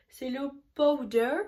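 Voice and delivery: Powder avec un accent anglais appuyé; English accent. Shown as a demonstration. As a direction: dire Powder à l'anglaise, lentement. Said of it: "Lol, mon accent". (Powder prononcé à l'anglaise)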